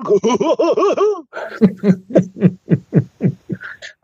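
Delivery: high, long run of hoots; exaggerated hooting caricature; mock southern hooting. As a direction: hoot away in a silly high voice. Southern hooting impression carried on.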